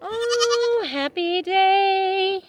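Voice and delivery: very high, slow cooing. Real, not acted. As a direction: stretch it out in a coo. Cooing to the animal.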